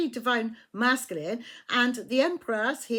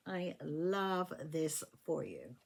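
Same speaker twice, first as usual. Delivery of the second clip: tender; 7 semitones lower, 10 dB quieter, slower than usual. Real, not acted. Delighted reading for Libra.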